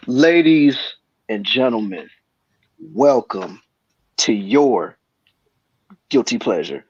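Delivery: slow, drawn-out show host welcome; announcer intro. Acted.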